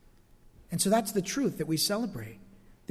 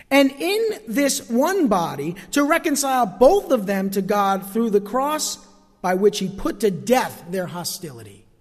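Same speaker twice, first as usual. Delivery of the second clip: preaching; 2 semitones higher, 5 dB louder, slower than usual. Acted. Slow emphatic scripture preaching.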